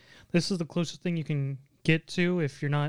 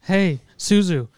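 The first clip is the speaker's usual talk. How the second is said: slow, much louder teasing hero voice; mock Matsuri; 2 semitones higher, 9 dB louder, slower than usual. Co-host playing Matsuri telling Suzu she is tasty, slow mock taunt. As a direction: drag it out like a smug taunt.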